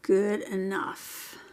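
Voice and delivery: drawn-out, slow "Good enough". Real, not acted. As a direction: stretch "Good enough" out slowly, settling. Repeating while finishing a piece.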